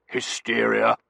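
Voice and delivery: loud, blustering verdict; colonel's scornful outburst; dismissive colonel. A narrator's reading. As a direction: pronounce it loudly with contempt.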